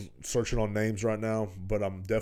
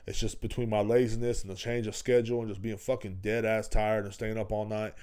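ordinary talk, nothing marked

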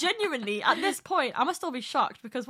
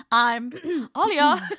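slow, throat-cleared I'm Alia, higher; mock grand intro; 3 semitones higher, 5 dB louder, much slower than usual. Teasing Alia about her slow intro, hammed up. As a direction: clear the throat, then drag it out. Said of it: "Alia's like".

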